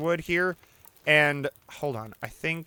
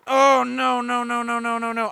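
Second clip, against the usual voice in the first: panicked no's; 9 semitones higher, 5 dB louder than usual. Real, not acted. Losing the rabbit.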